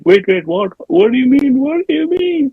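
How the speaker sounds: high, rising flustered pleading; mock panic. Nick Cage at gunpoint answering the cousin, mock panic. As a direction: plead in an exaggerated high voice, climbing with each question.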